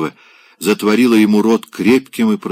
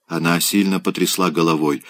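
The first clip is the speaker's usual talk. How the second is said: plain reading voice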